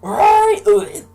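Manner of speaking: very high, very loud panicked shriek; screaming mom. Retold or imitated. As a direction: scream at them to hurry. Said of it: "my mom's screaming from the bathroom".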